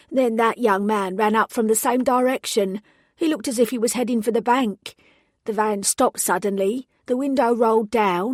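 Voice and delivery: put-on telling it in a London accent, shaken and eager. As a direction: witness recounting the shooting in a London accent. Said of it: "she spoke with a London accent".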